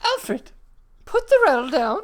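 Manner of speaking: loud firm order; stern scolding. Acted. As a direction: scold the child loudly. Scolding the child.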